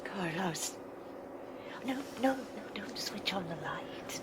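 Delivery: frantic hushed whisper; urgent whisper. Acted. Evelyn begging Carlos in the dark, whispering. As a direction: stop him, but keep it unvoiced.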